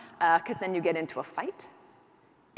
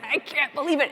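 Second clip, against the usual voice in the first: exasperated shout; 7 semitones higher, 6 dB louder than usual. Retold or imitated. Retold dinner table fight.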